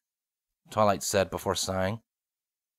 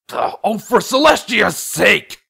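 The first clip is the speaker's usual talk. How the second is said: exasperated; 10 semitones higher, 8 dB louder, slower than usual. Acted. Nightlight breaks pattern, exasperated.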